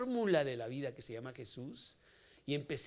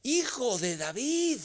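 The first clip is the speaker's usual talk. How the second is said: higher, louder and slow, a desperate call; loud cry; 9 semitones higher, 8 dB louder, slower than usual. Acted. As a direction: cry out to Jesus over the crowd. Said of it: "y grita".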